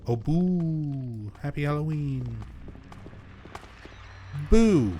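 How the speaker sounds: sudden loud playful boo; startling boo. Acted. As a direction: jump out and yell boo. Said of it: "Did I scare you?".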